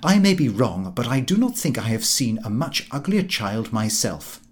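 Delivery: ordinary delivery, nothing marked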